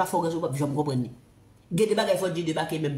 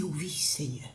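low, soft "oui, Seigneur" to God; humble reply; 8 semitones lower, 9 dB quieter, slower than usual. Retold or imitated. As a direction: answer the Lord quietly, head bowed. Répond à Dieu en vision. (réponse humble, voix basse et douce)

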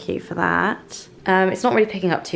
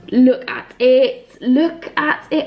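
high, slow, delighted gasp at the record; excited gushing; 6 semitones higher, 4 dB louder, much slower than usual. Real, not acted. Vlogger showing off her new vinyl, gushing. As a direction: swoon over the vinyl.

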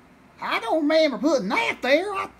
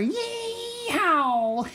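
gleeful yeehaw; 6 semitones higher than usual. Acted. Farmer gloats over catching turkey.

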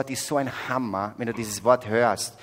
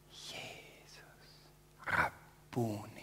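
Mary's hushed word; 3 semitones lower, 14 dB quieter than usual. Retold or imitated. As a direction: say it softly, in recognition. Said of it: "Dieses eine Wort von Maria".

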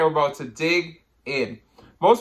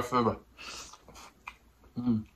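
muffled by food in mouth; talking while chewing; 5 semitones lower, 12 dB quieter than usual. Real, not acted. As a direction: mouth on corn cob. Seen 1: Bites corn cob from 0.8s, then chews; R frames show plain talking.

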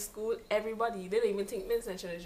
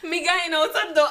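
put-on Vincentian accent; 7 semitones higher, 8 dB louder than usual. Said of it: "I know that I'm Vincent thing".

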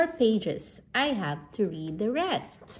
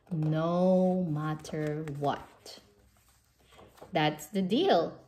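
plain reading voice

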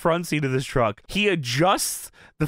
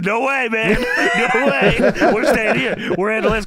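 louder and higher, hammed-up bravado; mock macho yell; 6 semitones higher, 5 dB louder than usual. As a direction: bark it like a tough guy showing off. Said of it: "He says".

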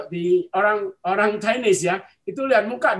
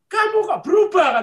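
higher, louder surprised question; startled fortune-teller; 10 semitones higher, 7 dB louder than usual. Retold or imitated. Fortune-teller to a returning client, startled. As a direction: imitate someone taken aback by a change. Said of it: "Loh, saya juga kaget".

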